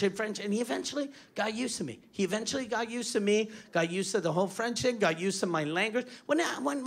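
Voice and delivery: ordinary talk, nothing marked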